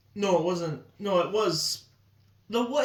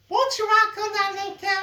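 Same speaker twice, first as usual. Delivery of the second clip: high cartoon voice; 9 semitones higher, faster than usual. Quoting a character.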